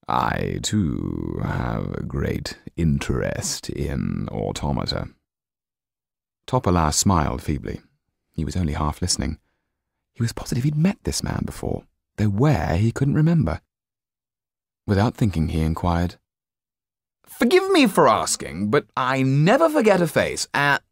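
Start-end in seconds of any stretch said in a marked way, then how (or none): 0.1-5.1 very low and slow, silky threat; deep, slow villain voice; menacing Count. Acted. The Count continues. 17.2-20.8 much higher and louder, eager showman; high, loud nervous jester voice; blurting Topola. Acted.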